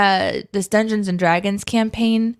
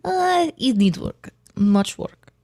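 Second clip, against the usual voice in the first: put-on Russian accent; 5 semitones higher, slower than usual. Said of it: "My Russians get".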